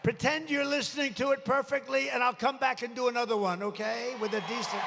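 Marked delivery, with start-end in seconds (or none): 0.0-2.3 1 calling out. Real, not acted. Yelling to crowd over bad mic.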